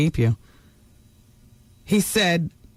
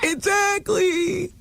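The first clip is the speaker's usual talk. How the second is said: drawn-out excited exactly; 10 semitones higher, much slower than usual. Real, not acted. Emphatic agreement after cooing.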